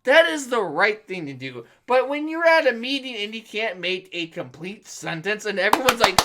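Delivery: normal talking voice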